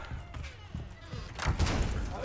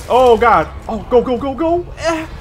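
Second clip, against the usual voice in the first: ordinary talk, nothing marked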